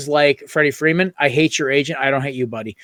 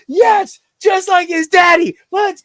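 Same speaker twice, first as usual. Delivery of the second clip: high, loud cheering shout; ballistic cheering; 5 dB louder, slower than usual. Retold or imitated. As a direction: yell it out like the winning hit just landed. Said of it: "I went ballistic".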